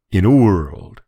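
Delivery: lightly imitating the trailer announcer; a touch of "In a world" drama; movie trailer voice. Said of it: "he's like".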